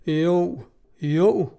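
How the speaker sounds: mild, drawn-out cries of assent; understated shout, rising on the second; crowd shout. A narrator's reading. Crowd answers from all sides, shouting mildly. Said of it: "råbte de fra alle sider".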